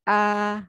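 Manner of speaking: "A" held high and level; first tone. Shown as a demonstration. Teacher shows the flat first tone to the class. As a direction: keep "A" high and flat all the way. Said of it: "itu gini cara pengucapannya".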